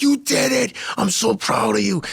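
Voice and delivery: high-pitched, emotional congratulation; overjoyed proud coach. Retold or imitated. Coach hugging the winning runner, overjoyed and proud. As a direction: burst with pride, pitch way up. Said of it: "like, ready to cry".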